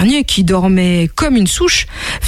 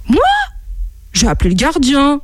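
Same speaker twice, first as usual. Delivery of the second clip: higher-pitched feigned disbelief; astonished; 6 semitones higher than usual. Acted. Monkey accused of calling the keeper, astonished. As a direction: act taken aback at the accusation. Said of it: "s'étonna le singe".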